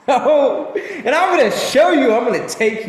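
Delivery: laughing high and loud. Real, not acted. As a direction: burst out laughing mid-sentence. Excited laughing promise to audience.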